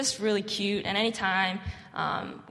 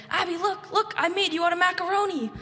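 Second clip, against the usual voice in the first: put-on much higher, eager kid voice showing off his craft; little boy voice; 10 semitones higher, 5 dB louder than usual. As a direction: play the proud little boy calling for attention. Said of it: "he'd be like".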